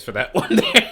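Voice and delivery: real laughter mid-sentence; laughing. Real, not acted. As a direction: break into a laugh at the joke. Joking about teachers smacking students.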